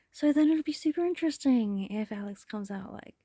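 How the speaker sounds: ordinary talk, nothing marked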